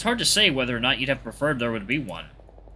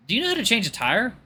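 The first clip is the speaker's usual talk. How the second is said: much higher pitch, rising at the end; hopeful question; 9 semitones higher than usual. Acted. Stranded driver asking Luke for help, hopeful question. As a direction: ask it high and tentative.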